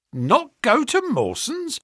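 high, slow incredulous question; astonished. A narrator's reading. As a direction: echo it back in disbelief, rising. Clerk astonished at the bet.

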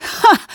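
a high, loud snorting Ha; scornful snort. Acted. Nina mocking the maid with a loud high snort. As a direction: snort out one sharp laugh of contempt. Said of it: "she snorts".

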